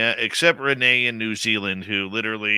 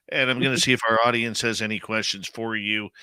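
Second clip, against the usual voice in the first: usual speaking voice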